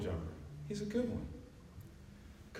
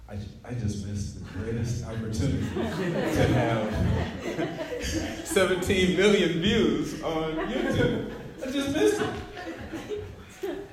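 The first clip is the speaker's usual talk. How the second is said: mock lament breaking up into high laughter; slow, drawn-out words rising into a laughing squeal; 3 semitones higher, 4 dB louder, much slower than usual. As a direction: lament a missed chance through helpless laughter. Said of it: "Now, I'm laughing because".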